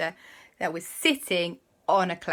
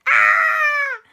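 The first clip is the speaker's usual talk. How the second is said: very high, loud, long shriek; shrieking ride scream; 11 dB louder than usual. Acted. Boy screams on the roller coaster, high shriek. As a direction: scream as on a big drop.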